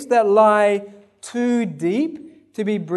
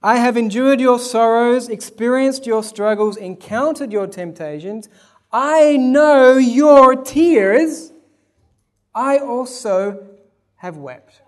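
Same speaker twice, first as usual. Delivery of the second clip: loud, rising fervour, slow on "I know your tears"; preaching; 6 semitones higher, 9 dB louder, slower than usual. Acted. Preacher proclaiming to the congregation, building then hushed. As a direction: build to a cry then fall to hush.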